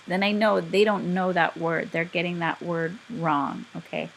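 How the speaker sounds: normal talking voice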